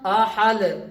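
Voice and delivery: आहल closed on a bare l; ending on laam. Shown as a demonstration. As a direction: stop आहल on the l sound, no vowel after. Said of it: "लाम की आवाज आती". (आहल के आख़िर में लाम की आवाज़)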